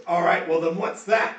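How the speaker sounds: loud, high orc challenge; orc Guz. Acted. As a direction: demand an answer like a big orc. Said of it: "he goes".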